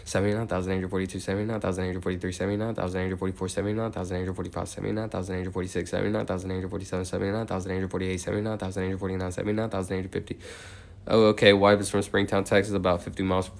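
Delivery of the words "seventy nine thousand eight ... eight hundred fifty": steady, rhythmic number chant; monotone counting. Real, not acted. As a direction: count on evenly in an unchanging tone, softer than chat. Counts aloud toward eighty thousand.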